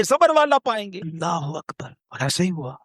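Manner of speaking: ordinary talk, nothing marked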